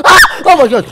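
loud startled cry; startled shout. Real, not acted. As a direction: jump at the fright. Jump scare.

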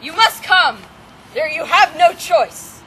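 villain command. Acted. Villain ordering the maiden, loud and commanding. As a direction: boom it down at the captive.

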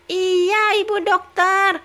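put-on high childlike baby voice; high-pitched little baby talking. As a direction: answer mama like a sweet toddler. Baby Tom Tom promises doctor.

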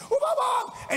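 put-on very high, overjoyed drunken greeting; drunk man. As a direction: imitate a drunk man crying out in a squeal. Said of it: "He was drunk, of course".

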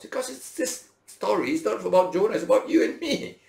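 high, loud preaching; preaching emphatically. Real, not acted. Preacher telling the congregation it's about them, emphatic. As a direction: drive home the point to the congregation.

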